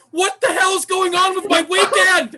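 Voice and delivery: loud, high, laughing disbelief; baffled outburst. Retold or imitated. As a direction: burst out in amused disbelief. Baffled outburst in retold story.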